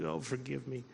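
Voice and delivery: soft, chastened plea; humbled plea. Retold or imitated. Answering God's condition for forgiveness, quiet humbled plea. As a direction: quietly beg pardon, voice dropped.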